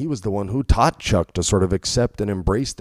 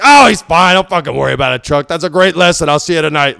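hearty dismissal; 5 semitones higher, 9 dB louder than usual. Retold or imitated. Godfather heartily brushing off the injury.

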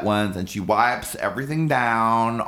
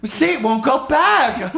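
whiny complaint; 4 dB louder than usual. Retold or imitated. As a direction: whine it pitched way up. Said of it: "It's like".

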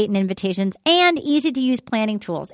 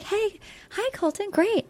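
soft high mock voice; 8 semitones higher, 8 dB quieter than usual. Voicing Heather quoted.

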